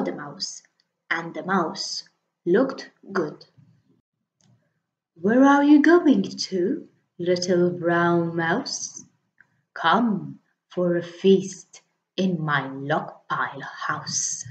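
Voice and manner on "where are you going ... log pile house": sly snake. Acted. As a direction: stretch it out like a snake. Snake voice hissing.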